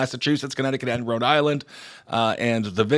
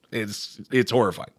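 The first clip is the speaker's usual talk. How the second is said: ordinary talk, nothing marked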